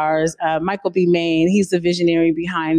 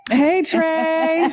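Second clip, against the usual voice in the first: high, bright call out; excited greeting; 10 semitones higher than usual. Real, not acted. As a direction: greet him with a lift. Guest greets host.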